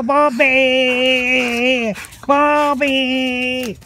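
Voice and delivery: high, long-held call; calling the dog. Real, not acted. Man calls out for Bobby, high drawn-out shout. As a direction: holler after the dog, stretching the name.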